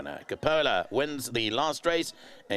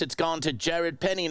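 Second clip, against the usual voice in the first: ordinary talk, nothing marked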